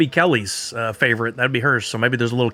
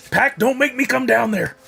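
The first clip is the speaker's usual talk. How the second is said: mock stern parent; 8 semitones higher than usual. Scolding the pack like child.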